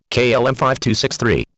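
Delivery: usual speaking voice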